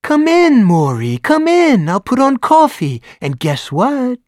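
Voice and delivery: high, loud, eager busybody; fussing neighbor. A narrator's reading. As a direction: fuss over him like a hostess. Neighbor fusses over Morris.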